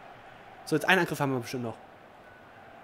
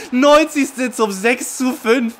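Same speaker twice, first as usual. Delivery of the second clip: slow and loud, higher, laughing through the score; laughing announcement; 6 semitones higher, 8 dB louder, much slower than usual. Real, not acted. Gamer announcing his late winner, slow and loud through laughter. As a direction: savour each word while still laughing. After winning goal.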